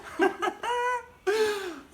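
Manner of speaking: high, loud burst of laughter; big real laugh. Real, not acted. Laughs at own story.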